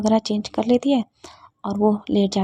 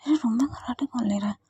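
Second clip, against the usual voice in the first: soft whispered question in the dark; whispered grumble; 7 dB quieter than usual. Acted. Siya woken by snoring, whispering to herself. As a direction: whisper it, half asleep and annoyed.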